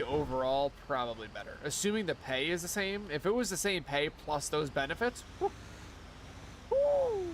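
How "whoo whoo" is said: high excited whoop; excited whoo. Real, not acted. Excited whoop about a better-paying job. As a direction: whoop at the thought of the benefits.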